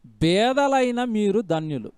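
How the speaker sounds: 'బేదలైన మీరు ధన్యులు' repeated slowly and deliberately; slow verse repeat. Shown as a demonstration. Preacher repeating the verse to his listeners, slow and weighty. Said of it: "బాగా వినండి ఇవన్నీ".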